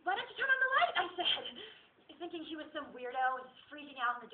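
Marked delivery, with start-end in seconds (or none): 0.0-0.9 high, loud comic question; exasperated retort. Acted. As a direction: toss it back high and loud. Quotes her own exasperated retort.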